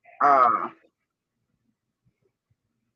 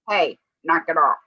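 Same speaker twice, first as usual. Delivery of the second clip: normal talking voice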